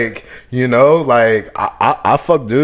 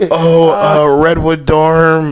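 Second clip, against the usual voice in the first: lightly mimicked girl, higher and louder; imitating a girl; 5 semitones higher, 6 dB louder, slower than usual. Girl telling him where she stays, imitated. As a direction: draw out a girl's hesitant answer, pitched up. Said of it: "She'd be, she'd be like".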